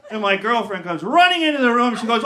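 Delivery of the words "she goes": ordinary delivery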